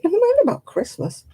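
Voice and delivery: high, dismissive scoff; mild mock indignation; scornful. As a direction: wave it away with scorn. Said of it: "I thought".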